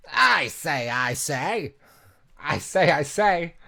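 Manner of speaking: loud high catchphrase voice; character catchphrase. Retold or imitated. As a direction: call it out like the character. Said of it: "I remember this character".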